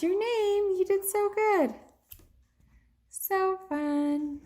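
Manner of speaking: very high, drawn-out gushing; high-pitched delighted encouragement; excited praise. Real, not acted. Praises child writing her name.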